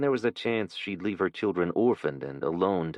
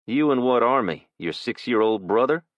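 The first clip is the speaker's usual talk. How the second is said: mocking, louder taunt; 4 semitones higher, 5 dB louder than usual. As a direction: taunt the punk. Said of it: "Jason taunted".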